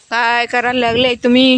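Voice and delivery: ordinary talk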